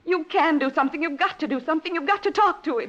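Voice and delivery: high, loud, pleading outburst; desperate anger. Acted. Wife forcing Matt to act, loud desperate demand. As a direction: push him hard, on the edge of panic. Desperate quarrel, gun drawn.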